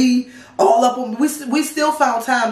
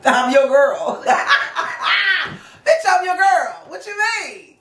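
laughing rant; 7 semitones higher, slower than usual. Retold or imitated. Cussing boyfriend out, laughing.

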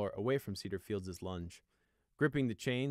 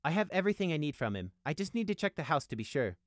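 higher-pitched, strained courtesy; controlled, civil explaining; forced politeness; 7 semitones higher, 3 dB louder than usual. A narrator's reading. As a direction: keep it polite against your will. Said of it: "forced himself to be polite".

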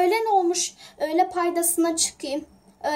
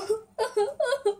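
tearful sob; 7 semitones higher, 4 dB quieter than usual. Acted. Raven crying after threat.

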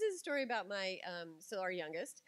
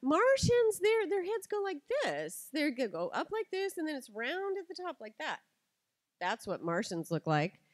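put-on loud, much higher, exasperated twelve-year-old; indignant daughter; 8 semitones higher, 7 dB louder than usual. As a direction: argue back hard, sure she is right. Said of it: "And Lucy goes".

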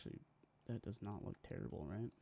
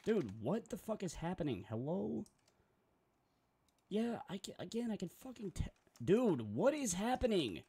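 high, loud frustrated shouting; losing it at the screen, voice climbing; exasperated yelling; 9 semitones higher, 5 dB louder than usual. Real, not acted. Streamer shouting at Photoshop as it keeps glitching, high and loud.

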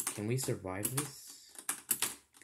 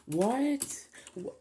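shocked high yell; a sudden loud shriek of disbelief; raging gamer; 8 dB louder than usual. Real, not acted. Lag spike mid game.

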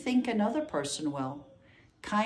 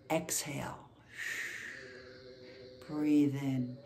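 guided meditation. Real, not acted. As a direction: lead the breath calmly, leaving space between phrases. Leads breathing meditation and blessings.

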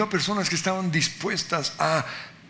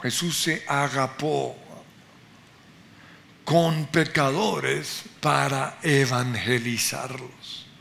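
normal talking voice

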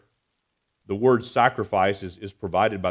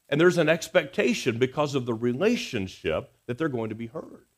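usual speaking voice

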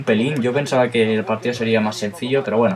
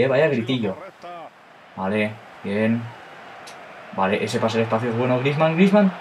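ordinary delivery

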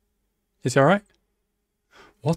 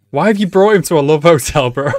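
baffled shout; 4 semitones higher, 8 dB louder than usual. Real, not acted. Loud baffled question.

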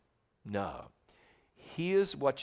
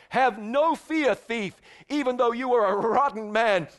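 louder and higher, building fervent preaching; fervent sermon build as Jesus; rising preacher's shout; 6 semitones higher, 4 dB louder than usual. Acted. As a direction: proclaim it to the thief with mounting force. Preacher voicing Jesus to thief.

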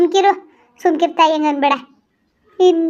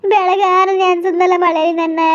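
crying; 3 semitones higher than usual. Acted. Wife telling the elder he left her soaked in the rain, slow sobbing. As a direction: drag it out through sobs.

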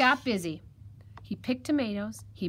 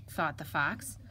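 plain reading voice

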